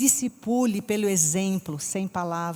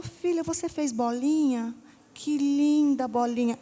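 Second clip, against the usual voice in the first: higher, slow, sweet praise; doting grandmother; 6 semitones higher, slower than usual. Retold or imitated. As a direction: coo over the child's drawing.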